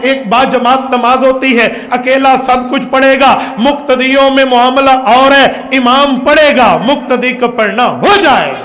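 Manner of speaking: loud, high-pitched sermon delivery; impassioned preacher in full flow; full-force preaching. Real, not acted. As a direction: drive each point home to the congregation.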